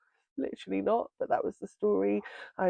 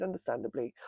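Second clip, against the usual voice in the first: normal talking voice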